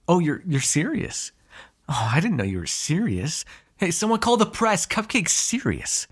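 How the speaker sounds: sarcastic, swooping disbelief climbing high; mock astonishment. Sarcastic at hunter being serious.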